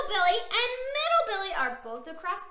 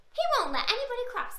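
ordinary delivery, nothing marked

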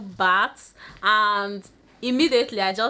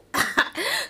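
short laugh. Real, not acted. Laughs at own hair plans.

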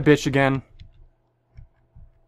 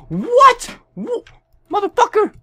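much higher, sudden shout of disbelief; shocked outburst; 4 dB louder, much slower than usual. Real, not acted. Gamer killed suddenly, shouting high in real shock. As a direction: yelp at the unfair kill.

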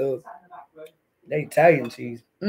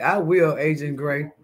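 ordinary talk

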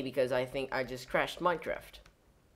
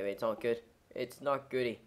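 ordinary talk

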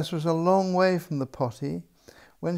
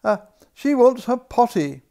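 crying out; 7 semitones higher, 9 dB louder than usual. A narrator's reading. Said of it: "cried the admiral".

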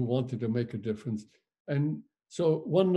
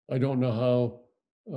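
ordinary talk

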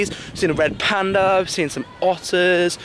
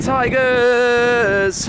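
calling out; 5 semitones higher, 3 dB louder than usual. Real, not acted. Calling out for tigers.

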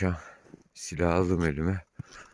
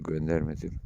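normal talking voice

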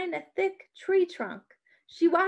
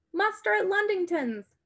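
higher urgent call; cried out; 7 semitones higher than usual. A narrator's reading. Sybil rousing a farmer at night, crying out. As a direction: sound the alarm, pitched up. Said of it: "Sybil cried".

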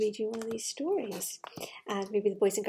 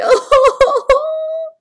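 shrieking high laugh; loud high laugh burst; 20 dB louder than usual. Real, not acted. Storyteller bursting into a loud high laugh. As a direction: let out a big squeal of laughter.

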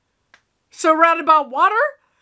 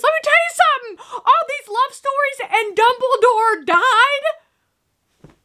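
high-pitched wail, stretched out at the end; outraged; 8 semitones higher, slower than usual. Real, not acted. Viewer ranting to the audience about Dumbledore's death, outraged. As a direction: rant high and drag out the final words.

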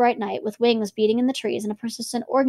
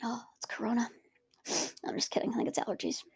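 much softer, barely voiced, joking; whispered joke; 13 dB quieter than usual. Real, not acted. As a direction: mutter a little joke under your breath. Whispered joke after a cough.

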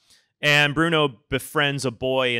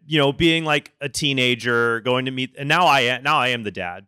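ordinary delivery

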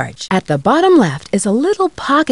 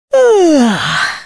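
high yawn sliding down; sleepy yawn; 6 semitones higher than usual. Acted. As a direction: let out a long waking yawn. Sleepy on waking.

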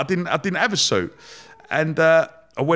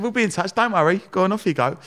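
higher-pitched mock reassurance; brisk reassuring send-off; 7 semitones higher than usual. Interviewer seeing off a hopeful candidate, breezy and higher.

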